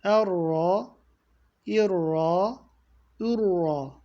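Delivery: Erra with a heavy, thick rolled r; Erra, er-ra, dark r; thick doubled r. Shown as a demonstration. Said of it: "ra kalın okunacaktı".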